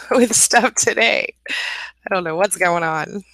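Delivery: drawn-out words through laughter; laughing in exasperation. Real, not acted. Host struggling with stuff today, exasperated laughing. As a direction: laugh helplessly, dragging the words. Laughs over tech trouble on stream.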